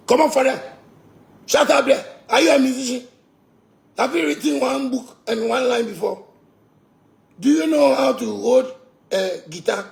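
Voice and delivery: high, loud scolding of a critic; angry retort. Real, not acted. Scolding a critic online, high and loud. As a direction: shout him down with contempt.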